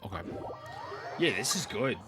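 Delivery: usual speaking voice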